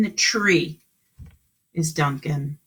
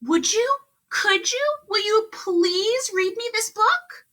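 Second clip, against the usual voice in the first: high, hopeful pleading voice; pleading Duncan. A narrator's reading. Duncan pleading with the mouse, high and hopeful. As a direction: beg sweetly, climbing with each ask. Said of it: "Duncan asked".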